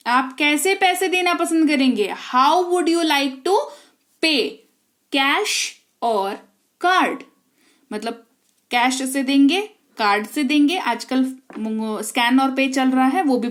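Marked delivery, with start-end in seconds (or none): 2.3-7.2 very slow, spelled-out English for practice; slow model sentence. Shown as a demonstration. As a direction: lay out every word slowly. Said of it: "teacher models the English line". (धीमी गति में अभ्यास वाक्य)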